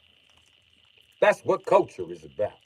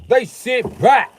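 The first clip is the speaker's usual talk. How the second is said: much higher and louder, slow; louder, slower mock preacher; 10 semitones higher, 6 dB louder, slower than usual. Shown as a demonstration. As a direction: drag it out, building up.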